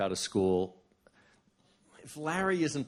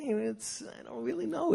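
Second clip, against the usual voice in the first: much higher, mock dithering voice; evasive friend; 4 dB quieter than usual. Mimicking a friend dodging on the phone, high and coy. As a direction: play him coy and unsure, up high.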